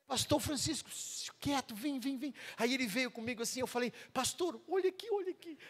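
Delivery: high, excited calls; urgent calling. Retold or imitated. Young man calling the pastor to come see. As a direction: hurry the pastor over, voice up high.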